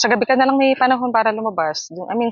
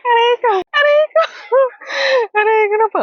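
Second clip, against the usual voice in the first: high, louder laughing ouch; laughing mock pain; 13 semitones higher, 3 dB louder than usual. Real, not acted. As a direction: cry ouch while cracking up. (natatawang aray)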